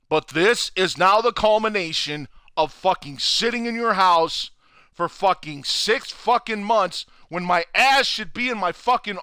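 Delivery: furious rant. Real, not acted. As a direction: rant it out high and hot, pitch climbing.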